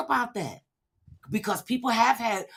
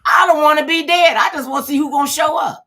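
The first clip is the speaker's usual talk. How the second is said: higher and louder, shouting with glee; excited; 8 semitones higher, 8 dB louder than usual. Real, not acted. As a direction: belt it out, delighted by the idea. Joking about her funeral.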